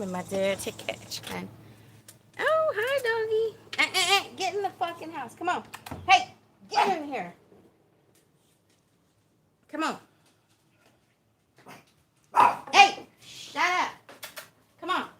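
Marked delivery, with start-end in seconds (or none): 2.4-3.6 high, loud doggy-greeting voice; excited high-pitched pet talk. Real, not acted. Greeting her dog. 3.8-15.1 shouting orders at the dog; high, loud, sharp commands to a pet; loud scolding. Real, not acted. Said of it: "Sorry if that was loud".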